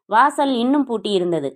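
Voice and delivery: ordinary delivery, nothing marked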